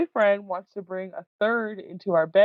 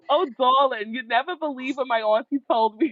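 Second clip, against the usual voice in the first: higher-pitched New York salon gossip; New York accent; 7 semitones higher than usual. Acted. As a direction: dish it out like a New York hairdresser. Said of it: "I'd pretend that I was in".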